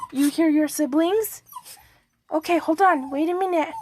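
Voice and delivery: high, cooing voice for the puppy; sweet pet-talk to the chihuahua. Real, not acted. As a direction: coo at the little dog. Talking to her dog.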